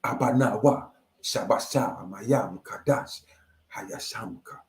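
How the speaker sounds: Abanawa, shabasha, mayam sounded out, fading to a whisper on hayashamka; ancestral tongue. Shown as a demonstration. Showing his people's old tongue, fading into a whisper. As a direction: chant Abanawa, shabasha, then whisper the last word kadash, hayashamka. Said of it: "That's how we sound".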